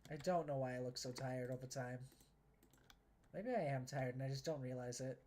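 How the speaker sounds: normal talking voice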